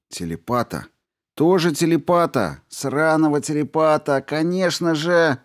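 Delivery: rising outburst of exasperation; exasperated. Acted. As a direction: build from muttered to exclaimed. Grey's exasperated realization. (раздражённо, с нарастанием)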